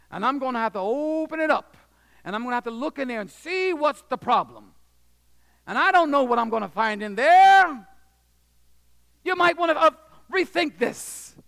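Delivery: surgeon pushing hard to scare them off; doctor warning, building. Retold or imitated. Surgeon warning the boy and parents, building to loud and high. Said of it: "And he said".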